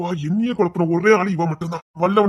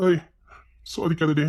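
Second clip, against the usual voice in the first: muttered warning; 4 semitones lower, 6 dB quieter than usual. Acted.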